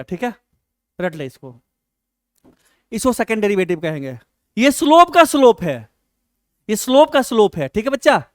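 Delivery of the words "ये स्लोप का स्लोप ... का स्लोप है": loud, insistent teaching line; emphatic. Real, not acted. Teacher hammering the key idea to students, loud. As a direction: drive the phrase home to the class, much louder. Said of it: "teacher drilling key phrase".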